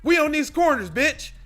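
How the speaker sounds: loud, high gang boss shouting; boss yelling. Acted. The boss shouts down his sidekick, loud and high. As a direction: bark orders as the boss. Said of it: "let me do it".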